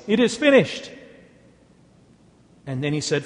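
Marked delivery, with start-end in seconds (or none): 0.1-0.9 high, loud final cry; raised-voice proclamation. A narrator's reading. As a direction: call it out strongly, pitch lifted well up. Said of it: "cried with a loud voice".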